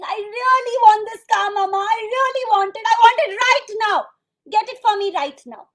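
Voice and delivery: put-on high, very loud demanding child; child's loud tantrum. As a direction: imitate a six-year-old wailing demands. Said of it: "crying louder and louder and louder".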